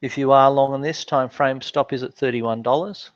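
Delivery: ordinary talk, nothing marked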